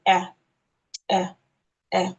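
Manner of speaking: short front "eh", as in American "cat"; each "eh" said alone, American short-a sound; American letter A. Shown as a demonstration. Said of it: "If you are going the American way".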